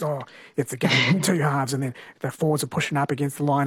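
low, blokey sports-commentator voice; blokey footy commentator. Acted. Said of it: "it sounds more blokey when they put it on".

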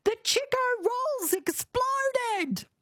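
very high, drawn-out wail at normal volume; mock scream. Presenter retells her sister's panic over the burning kitchen, mock screaming. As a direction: imitate a screaming sister, slow and high-pitched. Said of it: "running around screaming".

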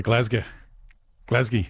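put-on "Glasgow" clipped fast in a mild Glaswegian accent, the second syllable swallowed; rapid Glaswegian. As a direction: imitate a Glasgow uncle rattling off "Glasgow" quickly. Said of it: "they'd be sort of said so rapidly".

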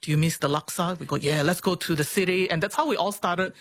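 ordinary talk

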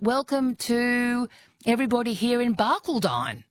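mock-formal welcome with Barcaldine ending in "dine"; imitated announcement. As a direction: announce it stately, stressing a long "dine" in Barcaldine. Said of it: "And she announced".

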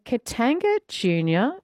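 ordinary talk

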